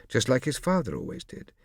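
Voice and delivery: usual speaking voice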